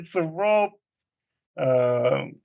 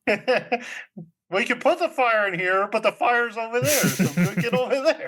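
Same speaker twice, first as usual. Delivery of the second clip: mock arguing; 7 semitones higher than usual. Mocking the housekeeper's argument in retelling.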